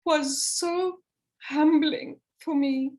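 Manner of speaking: real emotion breaking through; emotional, voice catching. Real, not acted. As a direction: hold back tears as you speak. Moved recalling friend's story.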